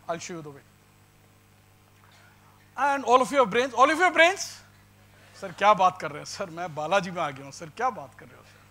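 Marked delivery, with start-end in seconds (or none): 2.8-3.7 a raised, rising demand to the room; loud challenge. Real, not acted. Lecturer loudly challenges the audience: loud challenge. 3.7-4.6 pushes it up high and loud, rising; louder, higher challenge. Real, not acted. Lecturer repeats the challenge to students: higher, louder challenge. 5.3-8.6 lightly voiced indignant student; mock student protest. Lecturer voices students answering him: lightly mocking protest.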